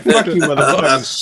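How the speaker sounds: a hint of a rapper's swagger. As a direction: toss it off like a gangsta rapper. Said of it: "I'm bringing [name] to the party".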